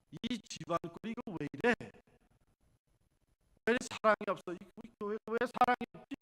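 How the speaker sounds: loud, irritated grumble; complaining. Retold or imitated. Churchgoer complaining there is no love, loud and irritated. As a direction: gripe about the cold house.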